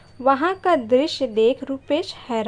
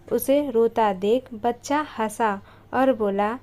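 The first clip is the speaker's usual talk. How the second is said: ordinary delivery, nothing marked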